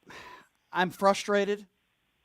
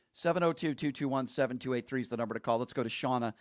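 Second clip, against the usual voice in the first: ordinary delivery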